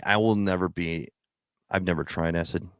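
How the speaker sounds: usual speaking voice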